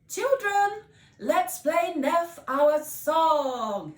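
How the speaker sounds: high, loud, slow call to the band; rally the little band; calling the children. Acted. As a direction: bandleader calling her players. Miss Potts calling band.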